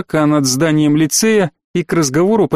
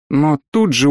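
ordinary delivery

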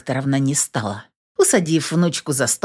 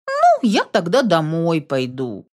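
ordinary delivery, nothing marked

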